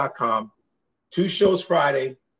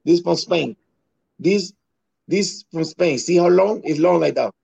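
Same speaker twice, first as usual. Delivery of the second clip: Dominican accent impression. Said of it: "you sound just like one of them".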